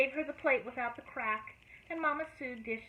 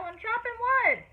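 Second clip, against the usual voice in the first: calling back; 11 semitones higher, 7 dB louder than usual. A narrator's reading. Joe calling from woodpile.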